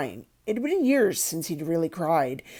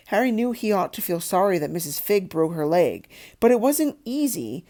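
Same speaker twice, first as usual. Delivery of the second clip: plain reading voice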